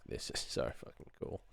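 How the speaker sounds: hushed, breathless whisper of amazement; awed whisper. Retold or imitated. Telling viewers how binaural feels, awed whisper. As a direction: whisper it like you can't believe your ears. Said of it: "I'm just like".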